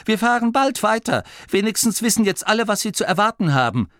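high, quick, cheery reassurance; upbeat, a little forced; forced cheerfulness. A narrator's reading. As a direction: reassure everyone with put-on cheer. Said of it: "fröhlicher zu klingen, als er sich fühlte".

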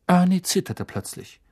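normal talking voice

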